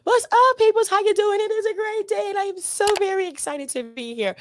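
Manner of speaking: high, loud radio host opener; hyped radio host. Real, not acted. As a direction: pump up the audience.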